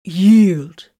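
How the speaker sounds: loud growling order; growled command. A narrator's reading. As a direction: growl it as a final warning. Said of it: "Titus growled".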